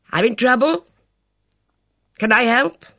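stranger offering help; man's voice. Acted. Driver voiced in story.

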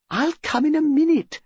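high, gushing country lady; excited hostess. A narrator's reading. Mrs Bantry telling Jane about the body, high gushing. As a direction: rattle on, thrilled and scandalised.

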